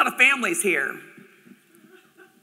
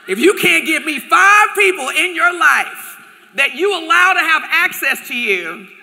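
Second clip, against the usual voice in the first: fired-up and louder; preaching; 5 semitones higher, 7 dB louder than usual. Real, not acted. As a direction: push it like a sermon. Preaching to audience.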